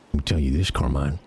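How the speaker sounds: deep, close warning; low menacing character. Acted. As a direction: lean in and warn in a low voice.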